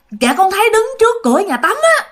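high-pitched scared reply; frightened child answering. Retold or imitated. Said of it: "sợ quá mà các bạn".

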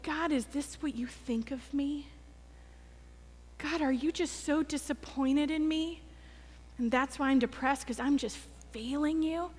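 soft, hurt questioning; hushed, wounded prayer, acted out; retold sinking heart. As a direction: plead quietly with God as if crushed. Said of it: "my heart sank".

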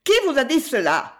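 high, loud suspicious snap; sharp retort. A narrator's reading. Wife snapping back at the lady, high and loud. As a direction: fire the question back. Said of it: "rétorqua la femme".

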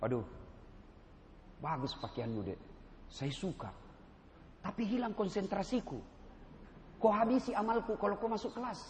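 soft, low, polite reprimand; teguran tidak kasar. Acted. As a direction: gently ask a student to wait outside. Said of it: "tapi saya tidak kasar ngomong". (menegur mahasiswa dengan lembut)

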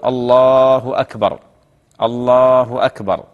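slow drawn-out adhan call, each phrase on its own; separate, sustained muezzin takbirs; takbirs called separately. Shown as a demonstration. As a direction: stretch الله long, pause between the two calls. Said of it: "مفردة، يعني كل يق-، يؤذن يقول".

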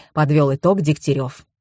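usual speaking voice

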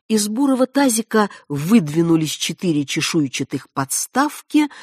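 ordinary delivery

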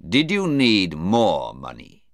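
plain reading voice

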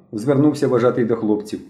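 plain reading voice

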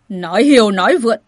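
loud, angry outburst; enraged rebuke; furious. A narrator's reading. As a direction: cut him off in a loud rage. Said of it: "Hán tử cao lớn nổi giận".

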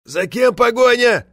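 high, slow shout of a deaf man; loud mishearing question. A narrator's reading. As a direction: call out loudly, having misheard. Hard-of-hearing man mishears, asks loudly. (громко, как глуховатый)

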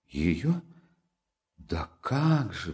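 slow, pitiful drawn-out reply; plaintive drawl. A narrator's reading. Sonya plaintively affirms her love for Katerina, slow and drawn out. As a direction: stretch it out plaintively. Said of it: "протянула Соня жалобно".

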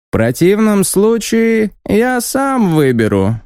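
high, slightly squeaky official announcement; pompous envoy reading a decree. Acted. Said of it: "высоким, громким и совсем немного писклявым голосом".

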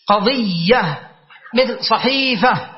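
slow قضية and صحيفة, both with held ii; drawn-out syllables. Shown as a demonstration. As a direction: stretch the middle of قضية to match صحيفة. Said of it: "كنا نقول صحائف".

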